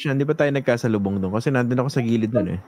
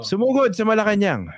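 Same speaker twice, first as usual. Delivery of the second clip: mild mock shout, pitched up; retold rally cry; 6 semitones higher than usual. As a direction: imitate a senator rousing a crowd, only half-shouted. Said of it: "Sumigaw eh". (kunwaring sigaw na panghikayat)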